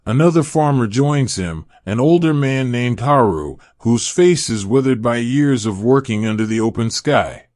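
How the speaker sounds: plain reading voice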